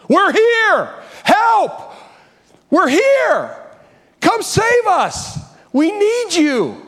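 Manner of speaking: high, loud shouting from under rubble; trapped men screaming to be found; desperate cry for help. Retold or imitated. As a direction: yell for rescue as if trapped. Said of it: "they cried out".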